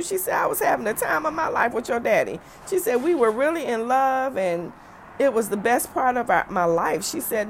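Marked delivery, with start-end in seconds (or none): 0.3-2.4 voicing mom. Mother voiced in retold phone call. 3.0-7.0 higher, slightly louder motherly protest; voicing mom. Mother voiced in retold phone call.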